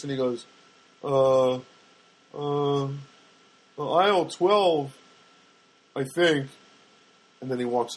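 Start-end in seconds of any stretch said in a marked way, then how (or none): 1.0-6.5 a slow, halting, lightly voiced clueless clerk; hesitant drawn-out answer; puzzled store kid. New store kid asked about dish liquid, slow puzzled voice. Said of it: "he gets his puzzled look on his face".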